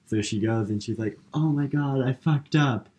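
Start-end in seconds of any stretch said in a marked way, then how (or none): 1.3-2.9 higher-pitched mock dismay; dismayed realization. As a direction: act out a horrified admission of a blunder. Acted dismayed realization in retelling.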